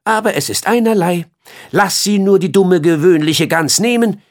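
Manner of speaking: flea. Acted. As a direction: sneer fast like a jealous flea. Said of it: "sagte der Floh".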